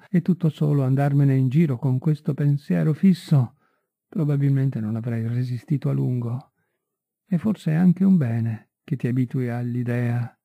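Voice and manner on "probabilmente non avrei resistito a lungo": ordinary delivery, nothing marked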